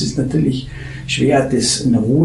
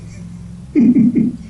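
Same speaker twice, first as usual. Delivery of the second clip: hohes, gehauchtes Auflachen; echtes Lachen; 13 semitones higher than usual. Real, not acted. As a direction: kurz und hell loslachen. Lacht über Ratschläge. (ein hohes, gehauchtes Lachen)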